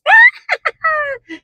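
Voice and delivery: squealing laugh; high laugh. Real, not acted. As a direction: burst of high giggling. Laughs at own witch attempt.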